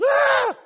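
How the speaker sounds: loud, high outburst imitating a demon; sudden scream. Preacher imitating the possessed man in the synagogue, sudden scream. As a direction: burst out in a sudden high yell. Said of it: "And all of a sudden".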